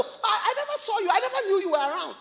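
high-pitched mock innocent protest; excuse-maker. Preacher imitating people who never searched hard, mock innocence. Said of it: "that's how they talk".

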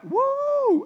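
very high, loud "woo"; high whoop of mock amazement; startled whoop. Boaz reacts to Ruth's marriage proposal, startled whoop.

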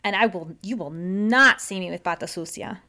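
ordinary talk, nothing marked

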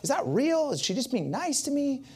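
high, incredulous wondering; unsure it was real, voice shooting up; amazed disbelief. Retold or imitated. Telling the church his disbelief, voice shooting up. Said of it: "And I'm thinking".

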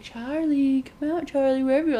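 playful calling. Retold or imitated. Girl calling Charlie in a game, playful calling. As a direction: call out as if playing hide-and-seek. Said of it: "Like, it's a game".

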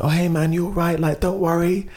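imitating a cheery football fan; consoling fan. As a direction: comfort a stranger after the loss. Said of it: "He's like".

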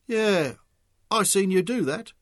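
supporter announcing. A narrator's reading. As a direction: shout your support. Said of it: "one supporter announced".

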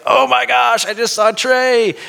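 high, loud fan gushing, acted out; starstruck awe. Fan just after seeing his idol, starstruck awe. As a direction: gush in a high voice like a thrilled fan. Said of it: "there was a sense of awe".

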